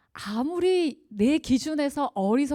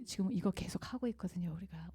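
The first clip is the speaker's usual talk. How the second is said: whisper; 3 semitones lower, 11 dB quieter than usual. Real, not acted. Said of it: "whispered aside".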